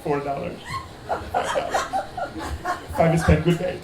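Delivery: quiet, halting put-on Ugandan accent; mock African accent. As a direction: stay stiffly in the foreign-student accent, with long pauses. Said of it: "doing this whole Yamol thing".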